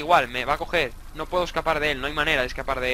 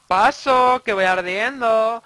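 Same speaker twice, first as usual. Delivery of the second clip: louder and higher, slowing on ardiendo; mock alarmed shout; 5 semitones higher, 6 dB louder, much slower than usual. Gamer runs through flames, mock alarmed shout. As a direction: yell it, dragging out the last word.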